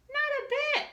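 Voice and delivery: squeaky high little voice; high squeak. Acted. Small character answering a friend in a squeaky voice. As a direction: chirp it in a tiny high voice.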